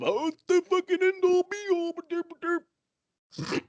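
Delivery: high-pitched derpy parody; mocking dimwit voice. Fan parodying the critics he is ranting at, mocking dimwit voice. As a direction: squeal it like a clueless know-it-all, stretching the derps.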